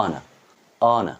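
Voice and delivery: অনা with silent h and no final r; careful British-style অনা, r dropped; British pronunciation. Shown as a demonstration. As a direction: say অনা as "o-na", ending open. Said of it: "এটা উচ্চারণ করব হচ্ছে".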